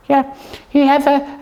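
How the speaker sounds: ordinary delivery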